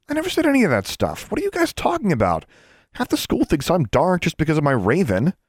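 high-pitched, exasperated defence; indignant protest. A narrator's reading. Alexandra defends herself to the twins, indignant. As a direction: protest hotly, pitch well up.

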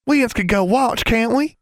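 put-on high, eager country girl voice. A narrator's reading. Innocence's country dialect, voiced high.

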